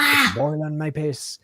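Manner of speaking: slow, lightly British phrase; mock British. Repeating the viewer's phrase in a light mock British. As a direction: savour it in a hint of British. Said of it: "a very British phrase".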